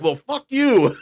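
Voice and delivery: high-pitched playful comeback; mock angry retort. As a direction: throw it back as a mock insult. Comic retort in retold quarrel.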